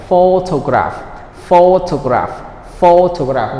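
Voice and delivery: PHOtograph, first syllable long and strong; photograph drilled with front stress. Shown as a demonstration. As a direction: hit the PHO in photograph, then fall away. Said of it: "is on the first syllable".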